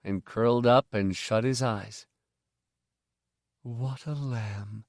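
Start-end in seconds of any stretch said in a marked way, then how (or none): 3.6-4.8 much softer, slow and fond. Acted. The mother watches Miv sleep, soft and fond. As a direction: sigh it with loving pride. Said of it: "the mother said".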